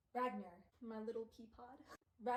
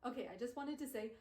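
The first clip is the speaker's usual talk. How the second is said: usual speaking voice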